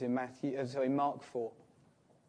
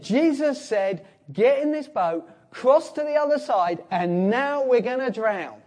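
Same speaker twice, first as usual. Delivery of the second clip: panicked disciples; 6 semitones higher, 4 dB louder than usual. Retold or imitated. Panicking disciples voiced in a storm.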